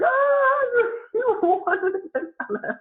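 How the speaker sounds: high, teary mock sob; crying. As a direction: imitate a friend crying with joy. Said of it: "she was, like, crying".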